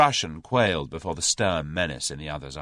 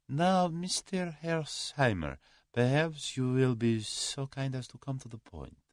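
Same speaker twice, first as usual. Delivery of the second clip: higher-pitched, measured Russian politeness; suave Russian; 8 semitones higher, 4 dB quieter than usual. A narrator's reading. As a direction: invite him formally to explain himself.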